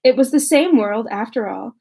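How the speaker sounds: steady, even audiobook read; calm literary narration; story narration. A narrator's reading. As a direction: read the chapter clearly to listeners. Reads novel chapter aloud.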